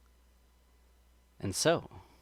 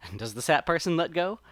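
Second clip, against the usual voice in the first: ordinary delivery, nothing marked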